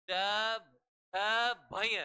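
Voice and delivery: झभय stretched very long; slow recital. Shown as a demonstration. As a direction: pull झभय out slowly. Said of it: "सूत्र है".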